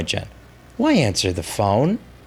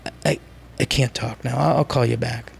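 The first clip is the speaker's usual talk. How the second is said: softer, sleepy brush-off; quiet hungover voice; 5 dB quieter than usual. Pretend. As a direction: mumble it like you just woke hungover. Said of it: "a legit dramatization".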